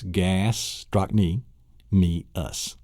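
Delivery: "gastrochny, me, us" slowly, in separated chunks; syllable breakdown. Shown as a demonstration. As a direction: pause between the parts of "gastrochny, me, us". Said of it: "It's actually four syllables".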